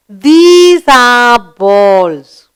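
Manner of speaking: very slow, every word stretched; very slow model. Shown as a demonstration. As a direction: spell it out for young learners. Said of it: "You will say".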